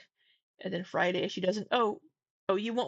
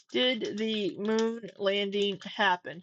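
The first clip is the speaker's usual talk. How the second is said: slow dictation; slower than usual. Real, not acted. Typing question into the chatbot.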